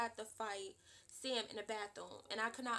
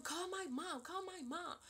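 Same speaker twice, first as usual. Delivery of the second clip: heated demand; 7 semitones higher than usual. Retold or imitated. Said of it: "'cause I'm heated".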